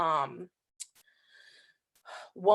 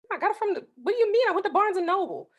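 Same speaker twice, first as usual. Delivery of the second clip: retold exasperated answer, pitched much higher; 12 semitones higher, faster than usual. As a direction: sound fed up at such a silly question. Exasperated reply to husband's question.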